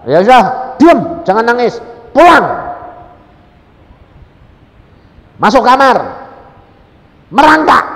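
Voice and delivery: loud, high firm directions; loud instructing voice; loud commanding instructions. Retold or imitated. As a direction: order her step by step. God's instructions retold loudly.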